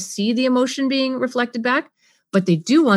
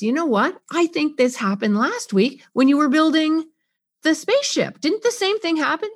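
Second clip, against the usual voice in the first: a touch higher, concerned; empathetic summary; 7 semitones higher than usual. Shown as a demonstration. Parent linking to last week's spaceship, concerned. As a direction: sum it up with concern. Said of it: "Hear my affect".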